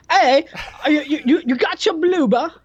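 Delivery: high-pitched, loud mock British; stammering British caricature, high and squawky; thick British accent. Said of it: "your British accent was so thick".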